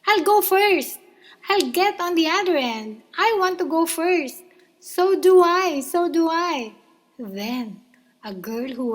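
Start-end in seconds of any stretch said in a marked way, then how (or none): 0.0-6.8 high, loud eager shouts; excited shouting. A narrator's reading. Children shouting to go first on the seesaw. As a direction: kids squabbling to go first. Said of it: "shouting with excitement".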